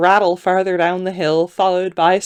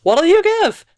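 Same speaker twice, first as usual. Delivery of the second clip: very high haggling voice; second man; 12 semitones higher, 4 dB louder than usual. A narrator's reading. Second man asking the lady for payment, high-pitched voice. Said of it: "said the second man".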